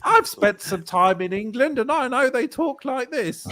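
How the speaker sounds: mocking a terrible English accent. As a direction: ape a bad fake English accent. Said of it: "She's like".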